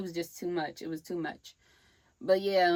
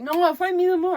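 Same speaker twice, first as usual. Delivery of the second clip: mock tough guy; 10 semitones higher, 6 dB louder than usual. Rome's cousin voiced in a fight story.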